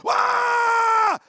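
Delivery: high, loud yell of alarm; loud cry. Retold or imitated. Swimmer crying out to the bailiff, loud. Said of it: "Je pousse un grand cri".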